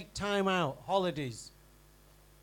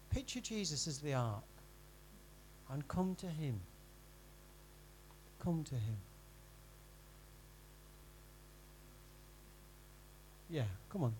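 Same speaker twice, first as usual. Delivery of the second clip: gentle appeal; 5 semitones lower, 14 dB quieter than usual. Real, not acted. Gentle altar call to weary.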